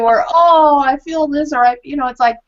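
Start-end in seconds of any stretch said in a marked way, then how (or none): 0.3-1.5 higher, louder, slow gushing pity; milk the sympathy, drawn out; syrupy mock empathy, high and slow; hammed-up sympathy.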